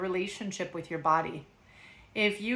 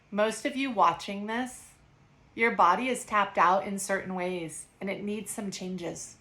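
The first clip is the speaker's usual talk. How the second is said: ordinary talk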